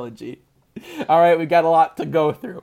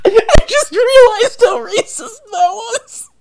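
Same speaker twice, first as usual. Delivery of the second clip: high-pitched breathless laughter, slow; cracking up at yourself mid-sentence; real helpless laugh through the words; laughing hard; 11 dB louder, slower than usual. Real, not acted. Laughing at own racist joke.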